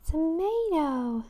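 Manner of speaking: Tomato said as to-MAY-do, slow and high; American tomato. Shown as a demonstration. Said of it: "See, I say tomato".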